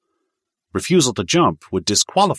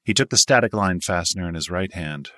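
usual speaking voice

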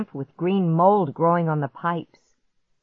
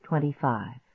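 ordinary delivery, nothing marked

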